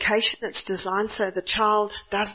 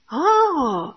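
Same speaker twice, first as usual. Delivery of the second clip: surprised oh; 6 semitones higher, 5 dB louder than usual. Retold or imitated. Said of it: "Peter said".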